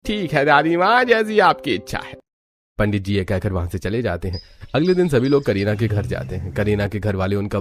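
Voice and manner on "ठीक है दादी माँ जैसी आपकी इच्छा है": high-pitched priest character voice; obliging family priest. Acted. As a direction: agree respectfully, pitched well up. Priest agrees with grandmother.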